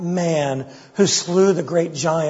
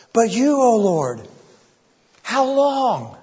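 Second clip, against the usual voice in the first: anguished plea; 7 semitones higher, slower than usual. Acted. Preacher voicing David's cry to God, high anguished plea. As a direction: voice rising high in desperate pleading. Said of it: "Can you hear it in his voice?".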